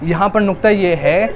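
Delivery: high, loud wailing preacher's lament, slowed; lamenting sermon. Acted. Preacher recounts Karbala's grief to mourners, high loud wailing lament. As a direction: cry the tragedy out to the mourners. Masaib recitation at full force.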